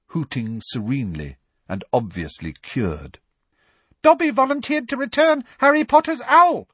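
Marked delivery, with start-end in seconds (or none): 4.0-6.7 very high, louder elf squeak; a devoted little elf, squeaking proudly; squeaky elf. A narrator's reading. Dobby reporting to Harry about his owl, squeaky and proud. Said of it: "said the elf squeakily".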